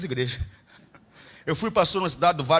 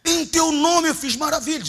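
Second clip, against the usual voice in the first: slow, high pleading; a believer pleading before the Lord; 10 semitones higher, 6 dB louder, slower than usual. Retold or imitated. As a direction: plead your works to the Lord. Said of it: "Aí ele vai falar assim".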